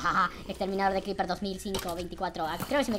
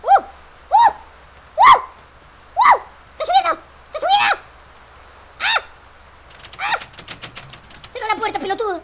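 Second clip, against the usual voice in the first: panicked shouting; 17 dB louder than usual. Real, not acted. Rain and creeper panic.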